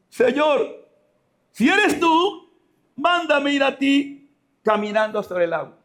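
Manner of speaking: high, stretched-out plea across water; Peter calling out. Acted. Peter asking Jesus to call him onto the water, high calling plea. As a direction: call up high, easing down by the end. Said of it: "Pedro, el capitán de la barca".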